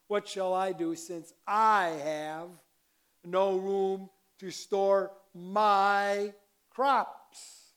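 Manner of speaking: loud, slow reading, stretching "I" and "my"; stress on I, my. Shown as a demonstration. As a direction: punch "I" and drag out "my". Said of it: "I'll accent it".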